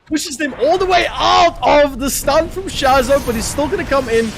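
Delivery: excited caster over the action; play-by-play casting. Acted. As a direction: call the fight as it unfolds. Live casting a team fight.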